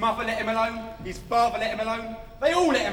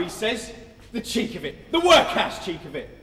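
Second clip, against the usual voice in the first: normal talking voice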